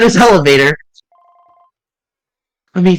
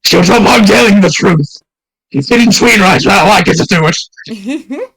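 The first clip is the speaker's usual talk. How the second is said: loud, higher villain shouting; angry shout; 5 semitones higher, 4 dB louder, slower than usual. Acted. Exposed impostor yelling at the accuser, furious. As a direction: bark it back in a rage.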